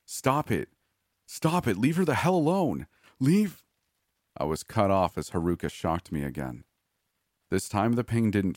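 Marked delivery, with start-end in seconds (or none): 0.1-3.6 rising, very high, loud frantic shouts; desperate screaming. Acted. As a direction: scream louder and higher each time. Captive screams at the killer.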